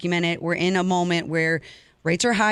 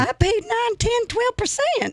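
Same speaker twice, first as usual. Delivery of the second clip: much higher, mock old Southern lady accent; Southern grandma accent; slower than usual. Grandma scoffing at Becky over rates, Southern accent. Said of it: "with a little Southern accent".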